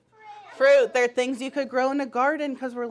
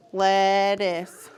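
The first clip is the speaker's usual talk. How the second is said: "Lettuce" slowly split in two, pitched lower; chopped into syllables; 4 semitones lower than usual. Shown as a demonstration. Teacher repeating the syllable chop for the class, slow and measured. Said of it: "we're gonna chop the syllables".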